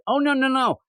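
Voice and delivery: high-pitched, emphatic brush-off; dismissive refusal. Acted. MJ throws Paul out, mock-indignant. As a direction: cut him off and send him packing.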